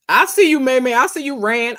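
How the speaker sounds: light up at a friend; excited call-out. Real, not acted. Spotting a viewer in the chat, excited call-out.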